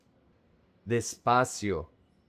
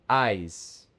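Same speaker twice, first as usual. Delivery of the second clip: usual speaking voice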